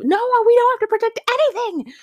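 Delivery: very high, loud, rushed refusal; outraged mock yell. Student refusing the teacher's task, outraged mock yell. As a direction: burst out in hammed-up outrage. Retold argument with chemistry teacher.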